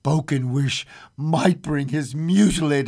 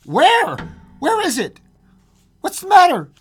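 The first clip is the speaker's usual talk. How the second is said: high, loud startled questions; alarmed; 8 dB louder than usual. A narrator's reading. Husband startled awake by his wife, loud alarm. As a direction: blurt it out in sudden alarm. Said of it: "he started up in alarm".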